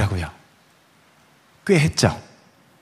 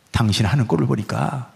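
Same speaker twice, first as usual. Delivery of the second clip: wife's mild half-tearful rebuke; understated sobbing retort to her husband; 6 semitones lower, 3 dB quieter than usual. Retold or imitated. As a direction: lower and softer, a touch of mock weeping. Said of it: "하고 울더래요".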